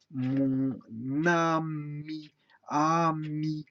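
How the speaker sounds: "Mon ami" very slow, mon-na-mi; syllable breakdown. Shown as a demonstration. Teacher decomposing the words, "Mon ami" drawn out. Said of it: "on a décomposé ici".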